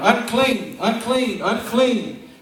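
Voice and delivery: loud, high warning cry, each word falling; hollering unclean. Acted. Leper running toward the crowd, crying out a loud warning. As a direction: call out a warning to keep the crowd back.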